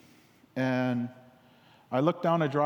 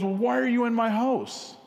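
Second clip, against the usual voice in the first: high, indignant challenge; indignant wife; 9 semitones higher than usual. Retold or imitated. Wife questioning the stranger at her table, high and indignant. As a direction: demand answers from a stranger in your home.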